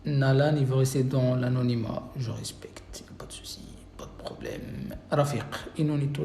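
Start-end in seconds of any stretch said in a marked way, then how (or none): 2.2-4.9 whisper. Real, not acted. Teacher aside to anonymous student.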